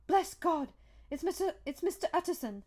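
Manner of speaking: high-pitched loud outcry; the cook's high, loud cry of relief. A narrator's reading. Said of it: "the cook, crying out".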